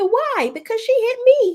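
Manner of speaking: imitating a child in a high-pitched voice; young son. Son answers mother about hitting a girl, high-pitched protest. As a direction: pitch way up, protesting like a little boy. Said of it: "And he said".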